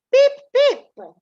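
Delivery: put-on piping baby chick call, high and loud; high chick peeps. Shown as a demonstration. Teacher showing children how an English chick sounds, high peeping. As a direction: peep like a tiny chick. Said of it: "in English goes".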